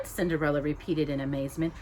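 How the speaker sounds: ordinary delivery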